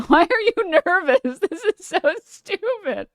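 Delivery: high laughing squeal at herself. Real, not acted. Streamer laughing at her own nervousness, high and squealing. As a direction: laugh through the words, high pitched.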